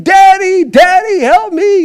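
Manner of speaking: high, loud desperate cry for help; a son being electrocuted shouts to his father, loud and high; crying out for daddy. Retold or imitated. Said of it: "and he's crying".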